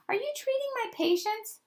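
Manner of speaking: very high, incredulous question; appalled. A narrator's reading. Doctor questions helper about her patients, appalled and high. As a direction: squeal it in disbelief.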